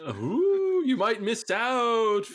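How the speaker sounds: very high, drawn-out spooky wail; hammed-up haunted-house warning, slow and high; ghostly taunt. Mock horror stories for board gamers.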